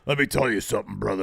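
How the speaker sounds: rough it up like a wrestler; put-on gruff promo opener. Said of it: "Rough it up even more".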